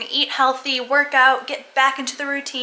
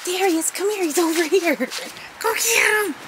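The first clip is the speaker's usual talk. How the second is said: excited pet voice; 7 semitones higher than usual. Real, not acted. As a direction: get the dog wound up to find dad. Excited pet talk, dad's home.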